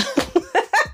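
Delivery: breathy high giggle at the story; a quick high laugh, amused; amused laugh breaking out. Real, not acted. Laughing at her own recap.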